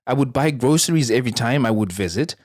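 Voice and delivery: usual speaking voice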